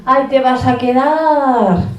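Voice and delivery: mock outrage, drawn out at the end; indignant inner voice. Mother seething at a parent who won't get up, loudly sarcastic. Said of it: "y yo pienso".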